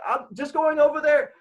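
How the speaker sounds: high, loud call over the shoulder; hurried brush-off. Retold or imitated. Brushing off police while walking away.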